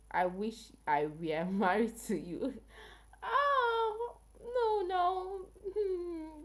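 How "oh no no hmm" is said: very high, drawn-out "oh, no, no"; high-pitched amused reaction. Real, not acted. Streamer reacting to a viewer's marriage wish, in a drawn-out high squeal. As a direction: squeal it, holding each sound long and high.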